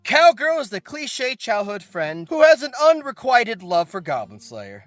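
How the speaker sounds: usual speaking voice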